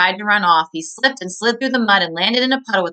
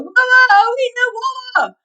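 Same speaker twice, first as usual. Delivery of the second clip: burning-mouth wail; 4 dB louder than usual. Acted.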